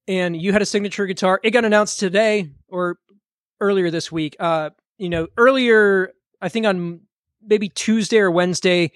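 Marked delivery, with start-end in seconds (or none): none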